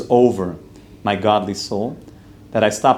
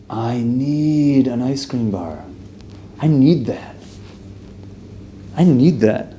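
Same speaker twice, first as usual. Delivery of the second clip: slow, craving, pitched up; craving whine; slower than usual. Retold or imitated. Person sees an ad and craves ice cream, slow whiny craving. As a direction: plead like an addict for a treat.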